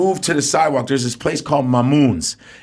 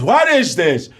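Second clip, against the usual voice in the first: Israeli owner yelling; 9 semitones higher than usual. Retold or imitated. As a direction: chase the guy off like a furious Israeli owner. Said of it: "he's very Israeli".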